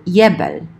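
"yebel" starting with y as in "yet"; "yebel" said YEH-bel; y-sound yebel. Shown as a demonstration. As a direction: begin "yebel" with a y glide. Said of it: "depending on the dialect".

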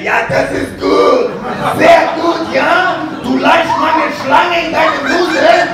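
loud, high, hammed-up German shouting; German dirty talk. Comic demonstrates dirty talk in German, shouted with mock passion. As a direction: get way too into it, yelling in German. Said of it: "dirty talk in German because it sounds".

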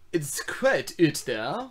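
put-on slow, drawn-out English South African accent. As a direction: drawl it like a colonial guide, English South African. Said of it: "keeps saying weird stuff like".

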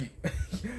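brief, quiet high-pitched chuckle; short laugh. Real, not acted. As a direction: let a small laugh slip mid-rant. Laughs amid the rant.

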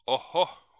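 sudden high, loud surprised cry; loud exclamation. A narrator's reading. Bingley protests to his friend, loud surprised exclaim. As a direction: burst out in mock dismay. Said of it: "huudahti Bingley".

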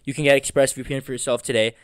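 polished, evenly paced ad read; sponsor read. Acted. Host thanking the sponsor for listeners, ad-read delivery. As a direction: read it out like a podcast sponsor spot.

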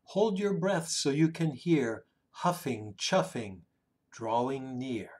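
usual speaking voice

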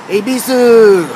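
slow, drawn-out mock Japanese accent; hammy Japanese accent, loud and high. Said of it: "trying to say everything with a Japanese accent".

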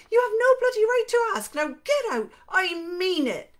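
high, loud West Country woman in a rage; furious shouting. Acted. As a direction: shout him out, pitched right up. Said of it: "close to shouting".

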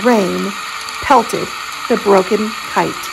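ordinary delivery

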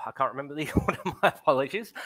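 ordinary talk

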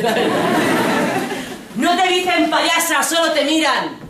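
alto, con risa burlona; laughing mockery. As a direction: se ríe a carcajadas burlándose. Imita la mirada despectiva con risa. (burla entre risas)

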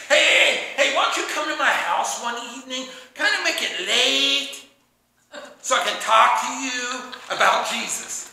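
hammed-up creepy coaxing; creepy weirdo. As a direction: stretch the words out suggestively like a weirdo. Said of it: "don't be weird".

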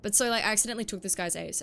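ordinary delivery

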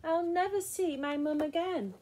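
high, plaintive storybook wail; lost child's high lament. A narrator's reading. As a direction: wail it, missing mum. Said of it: "she wailed".

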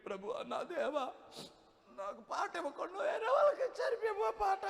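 hammed-up high whimpering prayer voice; mock whining prayer. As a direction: lampoon a self-pitying preacher crying to God. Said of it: "మన పరిచారకులు అలా ఏడవరు".

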